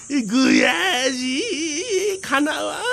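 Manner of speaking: high, slow, blubbering wail; frustrated crying. Acted. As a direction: sob in childish defeat. 小天狗が負けて悔し泣き. (悔し泣きの声)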